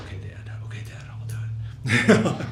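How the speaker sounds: whispered reluctant giving in; whiny resigned whisper. Retold or imitated. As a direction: grudgingly give in, in a whisper.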